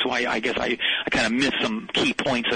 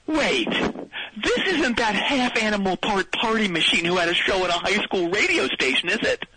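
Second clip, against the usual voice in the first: excited; 6 semitones higher than usual. Acted. Excited recognition of the show.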